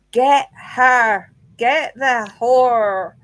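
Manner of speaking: loud, drawn-out imitation of a spirit voice; loud ghostly recording. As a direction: imitate the EVP, forceful and slow.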